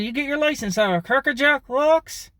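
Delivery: very high, incredulous ventriloquist jeer; without moving lips. Shown as a demonstration. Driver mocking someone's license, high, lips unmoving. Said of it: "I do without moving my lips".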